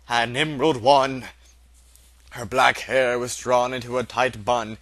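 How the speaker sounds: usual speaking voice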